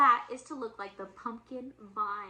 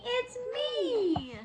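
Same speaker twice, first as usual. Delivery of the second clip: high pig voice; 10 semitones higher than usual. Acted. Pig answers in story.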